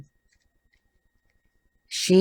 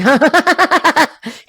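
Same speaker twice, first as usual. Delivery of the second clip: a loud, high burst of cackling laughter; high cackle; 11 semitones higher, 5 dB louder than usual. Real, not acted. Laughing at her own joke about the man, high cackle. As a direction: cackle at your own cheeky line.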